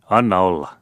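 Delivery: sharp, loud call to stop; the father shouts at his son not to shoot, loud; father's exclamation. A narrator's reading. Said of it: "huudahti isä".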